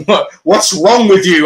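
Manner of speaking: mock exasperated question; amused disbelief. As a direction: tease him for not sweating.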